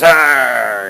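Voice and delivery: short mock monster roar; imitated sphinx roar. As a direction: growl out a playful roar. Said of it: "the sphinx goes".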